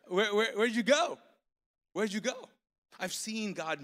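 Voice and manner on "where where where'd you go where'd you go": loud and higher, calling around for him; urgent mock hunt, louder; frantic calling. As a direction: search the room out loud. Searching for the man who left.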